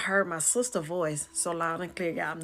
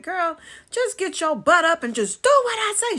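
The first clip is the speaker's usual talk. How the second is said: high, loud, exasperated sister ordering; imitated hollering; 12 semitones higher, 8 dB louder than usual. Twin sister orders her to get up, hollering. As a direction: holler the command, building to a yell. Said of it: "She started hollering at me".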